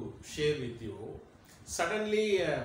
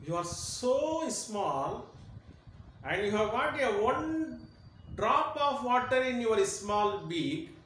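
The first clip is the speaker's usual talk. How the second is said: lion scoffing, higher and very slow, rising to much higher; mocking lion; 9 semitones higher, 4 dB louder than usual. Lion mocks the small bird carrying one drop, higher and slow. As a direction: drag it out, amused disbelief at the tiny bird. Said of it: "laughs and says".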